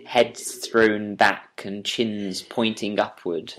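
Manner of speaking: ordinary delivery, nothing marked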